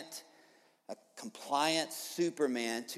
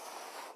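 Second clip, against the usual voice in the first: a long, deep, irritated sigh; 17 dB quieter than usual. Retold or imitated. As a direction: let out a weary sigh of irritation. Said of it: "he sighs deeply".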